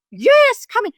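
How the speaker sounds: high, loud, gushing invitation to come in; acted-out effusive welcome; exuberant welcome. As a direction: welcome them in with a big high burst. Exuberant welcome voiced in retold story.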